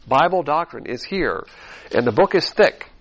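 normal talking voice

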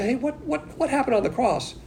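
high, hushed mock question; curious questioner. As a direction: ask it like a bewildered churchgoer. Imitating a curious churchgoer.

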